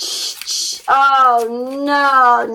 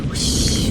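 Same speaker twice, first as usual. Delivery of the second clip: breathy whoosh. Acted. Wind in the story.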